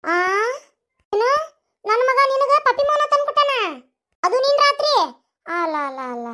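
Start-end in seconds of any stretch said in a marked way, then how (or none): 1.1-4.9 put-on higher, scolding old mother-in-law; higher-pitched scolding mother-in-law voice; bossy mother-in-law. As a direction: nag her like a village mother-in-law.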